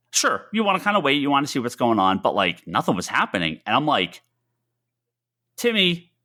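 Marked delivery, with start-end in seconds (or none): 5.6-6.1 calling out his name; yelling across the room. Retold or imitated. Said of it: "and I just yell".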